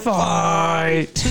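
high, drawn-out mock battle cry; imitated yell. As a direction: echo the yell, stretching it long. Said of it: "left the bench and yelled this".

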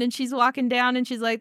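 usual speaking voice

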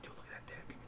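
hushed, breathless whisper to a friend; sneaky locker-room whisper. Retold or imitated. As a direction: whisper it so nobody nearby hears. Whispering about a man in the locker room.

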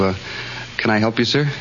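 ordinary delivery

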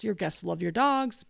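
ordinary delivery

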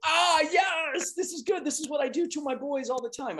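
very high, hammed-up British voice; mock British accent. Cohost teasing embarrassed friend, high mock British accent. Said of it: "teach them the difference between a British accent".

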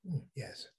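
low, very soft godfather murmur; Shia Godfather impression. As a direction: do a hushed, solemn mob boss. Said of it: "he was like the Shia Godfather".

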